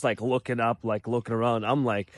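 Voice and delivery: normal talking voice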